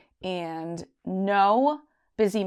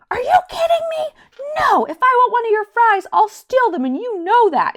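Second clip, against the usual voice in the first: much higher, loud shrieking outrage; mock outrage; 10 dB louder than usual. At her fiancé over the fries, a high mock-outraged shout. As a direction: shriek it in playful disbelief. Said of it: "And I was like".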